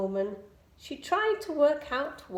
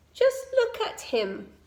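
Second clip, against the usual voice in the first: mild scoffing; 9 semitones higher than usual. A narrator's reading. Bees scoff at Amelia.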